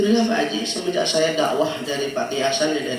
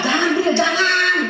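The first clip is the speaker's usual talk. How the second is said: urgent plea; 5 dB louder than usual. Acted. Prophet stops Jibril.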